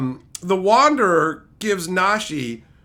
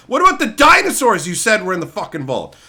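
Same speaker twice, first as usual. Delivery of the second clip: ordinary talk, nothing marked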